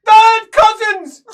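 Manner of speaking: very loud, high, drawn-out yell; shouted back. Acted. Game master voicing the cousin answering back, shrill shout. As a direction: bellow it back at full pitch. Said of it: "he shouts back".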